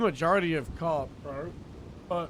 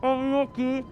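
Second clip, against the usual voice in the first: higher, friendly roleplay voice; high character voice; 8 semitones higher, 3 dB louder, slower than usual. Acted. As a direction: put on a higher, lighter character voice.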